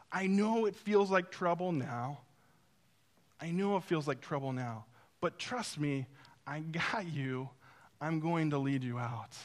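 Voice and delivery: soft, lowering, tender delivery; calm reassurance. Retold or imitated. Jesus saying to his disciples, calm reassurance.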